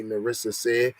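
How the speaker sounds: ordinary talk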